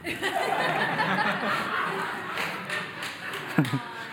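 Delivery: breathy laugh. Real, not acted.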